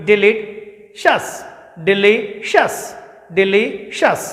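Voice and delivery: delicious said slowly and clearly, de-LI-shus, rising then falling; slow model pronunciation. Shown as a demonstration. Teacher modelling delicious for students to repeat slowly. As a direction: spell out delicious slowly for the class to copy. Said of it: "Repeat after me".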